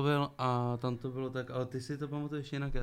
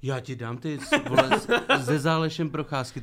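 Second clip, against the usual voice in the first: laughing retort; 3 semitones higher, 8 dB louder than usual. Real, not acted. Laughing while teasing about walks.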